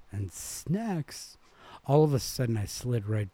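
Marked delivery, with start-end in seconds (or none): 0.3-1.4 "snacks" lifted very high, falling away; ticked-over words. A narrator's reading. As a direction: flick "snacks" up high and let it fall. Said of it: "the way it ticked over".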